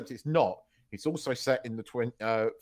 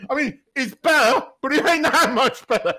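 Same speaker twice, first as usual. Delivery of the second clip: high, loud, laughing protest; words bursting through real laughter; helpless laughter; 14 semitones higher, 9 dB louder than usual. Real, not acted. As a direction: squeal it out while cracking up. Repeats protest while cracking up.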